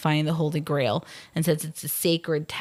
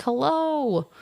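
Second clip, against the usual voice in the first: mock call; 8 semitones higher than usual. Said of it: "they're like".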